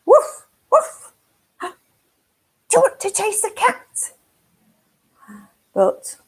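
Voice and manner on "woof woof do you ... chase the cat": high, loud yappy woof, then the question; dog voice. Acted. Dog calling to the spider, high and loud. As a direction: bark it out like an excited puppy. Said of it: "and the dog said".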